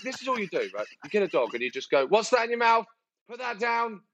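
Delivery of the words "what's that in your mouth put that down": higher scolding of a dog. Retold or imitated. Owner scolding the dog chewing things, high sharp scold.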